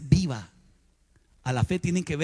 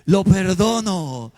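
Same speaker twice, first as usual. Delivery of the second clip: slow forced forgiving; 4 dB louder, slower than usual. Retold or imitated. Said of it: "pasaba al frente de aquella oficina y decía".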